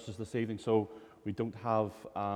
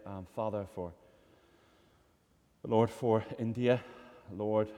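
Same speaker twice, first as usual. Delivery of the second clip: urgent prayer over the sick; 5 semitones lower than usual. Acted. Pastor leads congregational prayer.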